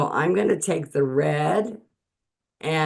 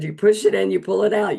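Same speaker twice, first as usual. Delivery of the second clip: excited retelling; 6 semitones higher, 5 dB louder, faster than usual. Retold or imitated. Retold demo line, excited.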